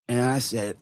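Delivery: usual speaking voice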